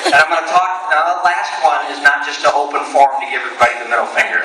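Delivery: ordinary talk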